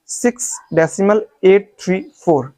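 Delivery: एट, three, four as separate digits; correct reading demo. Shown as a demonstration. Teacher reading in English, digit-by-digit demonstration. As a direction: give एट three four a beat each. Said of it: "तो आप ऐसे पढ़ोगे".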